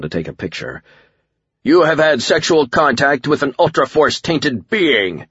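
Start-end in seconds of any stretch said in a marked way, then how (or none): 1.6-5.3 high, booming villain shout; shouted accusation. A narrator's reading. Alien warlord points at the narrator, shouting an accusation. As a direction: accuse at full projection. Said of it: "Akuma shouted".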